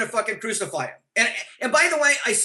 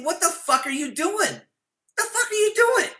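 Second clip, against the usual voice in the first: high-pitched mock disbelief, rising higher on the repeat; incredulous retold outburst; 9 semitones higher than usual. Manager silently appalled as his partner insults the singer, high incredulous retelling. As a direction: voice an appalled inner protest, pitch climbing without shouting.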